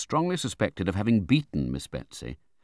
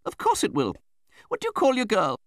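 high, brusque matron; Betsy Trotwood; 5 dB louder than usual. A narrator's reading. Aunt brushes off the widow's doubt, brusque. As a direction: dismiss the worry briskly, high-pitched.

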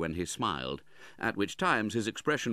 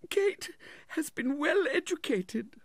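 slow, very high, tearful voice; sobbing; slower than usual. A narrator's reading. Widow speaks up for her daughter, sobbing. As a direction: wail it slowly as the weeping mother. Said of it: "sobbed Missus Nickleby".

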